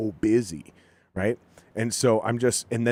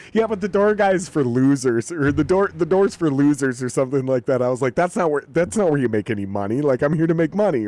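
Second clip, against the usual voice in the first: louder, higher retold protest; defiant comeback; 5 semitones higher, 6 dB louder than usual. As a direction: push back loudly, imitating your younger self. Retold defiant comeback to his boss.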